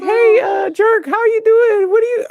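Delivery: high, slow kid voice imitated; playful high child impression; kids greeting. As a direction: mimic a little kid saying hi, way up high. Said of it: "They be like".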